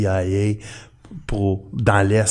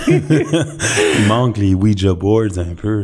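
laughing; slower than usual. Real, not acted. Joking about a séance setup.

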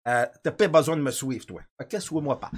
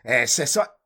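ordinary talk

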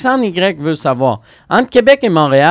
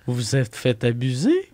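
lower, softer TV host question, climbing at the end; Claire Lamarche; 4 semitones lower, 6 dB quieter than usual. Acted. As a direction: imitate a host gently questioning a guest. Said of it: "Puis elle était comme". (imitation d'animatrice, question posée tout bas)

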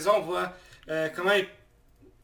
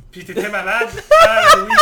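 loud laughing; 12 dB louder, faster than usual. Real, not acted. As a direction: crack up mid-sentence. Teasing, laughing.

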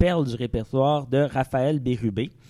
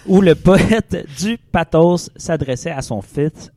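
hammy stage actor; 2 semitones higher, 7 dB louder, slower than usual. Acted. Hammy chronicle reading.

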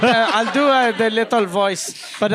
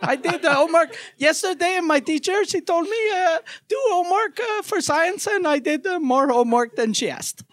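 put-on higher, small-kid voice with big words; little child voice; 5 semitones higher than usual. Child on Santa's knee bragging about homework, higher kid voice. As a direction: talk like an eager seven-year-old. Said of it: "I'll do the little voice".

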